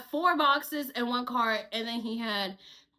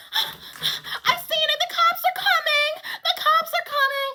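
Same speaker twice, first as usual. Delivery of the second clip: much higher, panicked cry that the cops are coming; frantic Messy; 13 semitones higher, 4 dB louder than usual. Retold or imitated. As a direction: squeal it like a panicked friend. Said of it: "here comes Messy out of nowhere".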